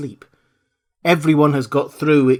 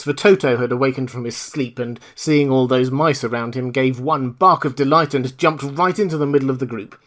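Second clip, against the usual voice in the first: ordinary delivery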